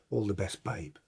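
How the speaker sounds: low, soft voice; imitated send-off. Teammate wishing the substitute luck, low and quiet. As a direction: drop it low and quiet, as the other player. Opponent's send-off before kickoff, acted.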